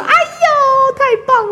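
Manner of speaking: high, gloating mock cheer; smug triumph. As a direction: crow it like a proud mom. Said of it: "很得意的笑".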